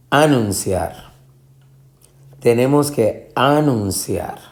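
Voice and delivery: anunciar stressed on its opening A; misplaced first stress. Shown as a demonstration. Teacher trying options, A-nunciar with first syllable stressed. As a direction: hit A in anunciar and fall away. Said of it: "ni va a anunciar".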